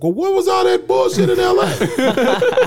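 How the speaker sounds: loud, very high, drawn-out protest; comic indignation. Retold or imitated. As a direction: burst out in laughing disbelief. Indignant comeback to director.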